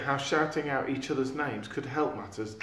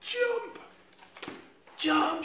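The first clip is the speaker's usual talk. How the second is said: shouting. Acted. Sid shouting up at Eric to jump. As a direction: yell up to the boy to jump. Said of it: "shouted Sid".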